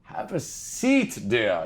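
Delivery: lightly voiced fond grandmother; grandmother's greeting. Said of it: "She would insist on it".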